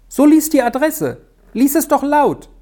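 high, urging schoolboy voice; excited boy begging his friend to read aloud; eager boy pleading. A narrator's reading. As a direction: coax your friend to read it out. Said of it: "bat Georg".

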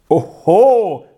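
put-on loud, outraged boy's oho; boy indignant at his friend's doubt. A narrator's reading. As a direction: scoff as if offended. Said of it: "rief Georg entrüstet aus".

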